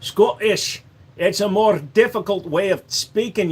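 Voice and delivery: a light Scottish put-on; Scottish accent. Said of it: "not to get Scottish right".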